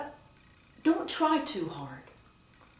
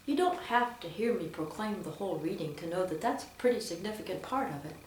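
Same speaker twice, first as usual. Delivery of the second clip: usual speaking voice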